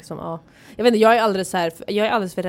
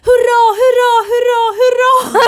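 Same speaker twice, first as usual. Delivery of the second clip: high, loud cheering hurra hurra called out from another room; joyful shouting; 10 dB louder, slower than usual. Retold or imitated. Imitating the old lady cheering from the toilet, loud and high. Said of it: "Och så här ropar hon".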